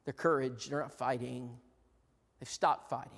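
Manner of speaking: normal talking voice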